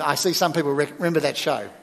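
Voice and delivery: ordinary talk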